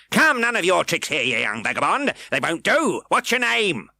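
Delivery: high, loud harsh questioning; bully the boy; bullying magistrate. A narrator's reading.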